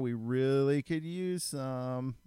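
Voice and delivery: slow, drawn-out hinting voice; lightly hammed-up wife dropping hints; imitating wife's hint. Wife hinting on the phone about creamer, slow mock hint. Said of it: "call and be like".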